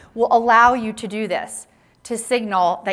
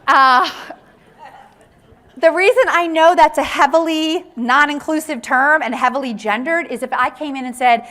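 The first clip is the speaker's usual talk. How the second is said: ordinary delivery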